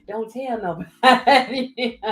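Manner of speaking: slow, giggling through the words; laughing. Pretend. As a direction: let the laugh slip through a joking secret. Whispered secret joke, laughing.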